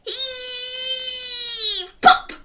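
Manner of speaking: loud, high heave then pop; heaving shout. Pretend. Final pull with children, loud heave. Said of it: "grab onto the leaves and say".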